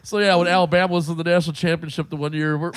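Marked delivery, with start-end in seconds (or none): none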